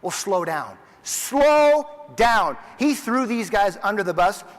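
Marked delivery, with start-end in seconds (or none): 1.0-2.6 louder and higher, a sharp order; stern scolding shout. Retold or imitated. Harmon scolding the players, stern shout. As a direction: rein them in hard.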